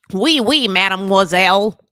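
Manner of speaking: "Oui, oui, mademoiselle" with a hard American R, loud and hammed; mock French with a heavy rhotic American accent; bad American French. Shown as a demonstration. Host mocking Americans who butcher French, loud hammed American-French accent. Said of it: "speak it with the worst rhotic accent ever".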